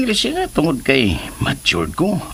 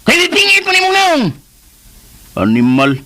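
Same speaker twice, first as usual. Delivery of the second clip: loud, high angry scold; angry yell; 13 semitones higher, 5 dB louder than usual. Acted. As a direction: yell it at him in anger. Shop quarrel, scolding. (nasuko nga singgit)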